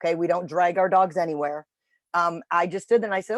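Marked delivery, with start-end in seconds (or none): none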